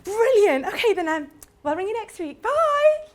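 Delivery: high, hurried phone sign-off; nervous chirpy brush-off. Retold or imitated. Imitating her young self ending the call, high bye. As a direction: bail out brightly before money. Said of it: "and I'd go".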